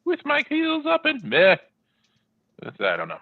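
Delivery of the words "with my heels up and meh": high silly squeal; squeaky high voice. Acted. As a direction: squeak it out playfully.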